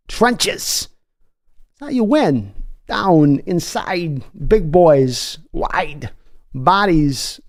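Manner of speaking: Christopher Watkins impression. Answering a viewer, talking to Seahawks fans as a hyped pundit. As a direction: preach trenches like a fired-up football sage. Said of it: "What would Christopher Watkins say".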